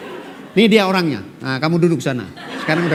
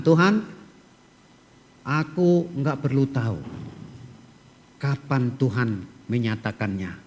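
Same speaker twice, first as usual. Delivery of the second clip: soft, hushed trusting prayer; quiet prayer; 5 semitones lower, 5 dB quieter than usual. Pretend. Believer prays to God, soft and trusting. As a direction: pray it softly, in full trust. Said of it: "orang yang mengerti menantikan janji Tuhan".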